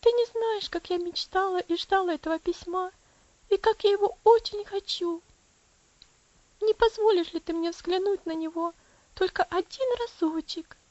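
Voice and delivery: put-on high, pleading little girl voice; девочка Элси просит. Girl begs father for letter. (высоким голосом девочки, умоляюще)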